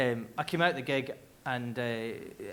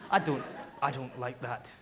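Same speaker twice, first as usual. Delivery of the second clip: normal talking voice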